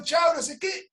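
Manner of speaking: very high mock farewell; playful re-enacted goodbye. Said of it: "le digo".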